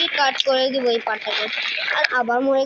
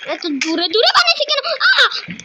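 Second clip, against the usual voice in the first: startled yell; 10 semitones higher, 4 dB louder than usual. Real, not acted. Nearly falls in the game.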